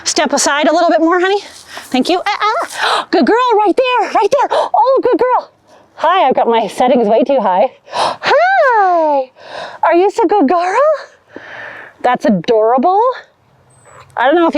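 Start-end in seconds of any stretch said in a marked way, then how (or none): 2.2-5.5 talking to a dog. Real, not acted. Praising dog during pose. 7.8-10.8 high, sing-song pet voice; talking to a dog. Real, not acted. Cooing at dog.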